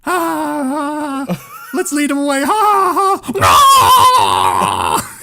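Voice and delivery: high, jeering theatrical laughter; hammed-up mocking villagers; mock stage villagers. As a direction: laugh and jeer like a cruel crowd. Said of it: "people on stage are like".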